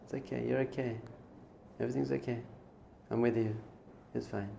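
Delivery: very soft, soothing reassurance; little still voice. Acted. God's small voice comforting the listener, hushed and tender. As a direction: murmur comfort gently, with pauses. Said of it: "there's a little voice all the time".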